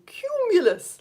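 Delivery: high, bright call of cumulus; excited cumulus call. Shown as a demonstration. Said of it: "as if you're kind of herding kittens towards you".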